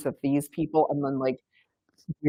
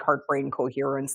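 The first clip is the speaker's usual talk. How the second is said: normal talking voice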